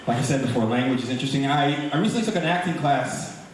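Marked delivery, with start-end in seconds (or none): none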